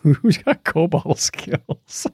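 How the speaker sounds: ordinary talk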